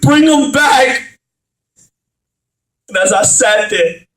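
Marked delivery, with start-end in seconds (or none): 0.0-1.2 grieving father begging God to bring his son back, desperate; desperate plea. Acted. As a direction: plead for him back.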